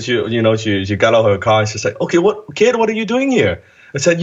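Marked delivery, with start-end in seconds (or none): 2.0-3.6 mock surprised lady. Lady confronts kid on lawn.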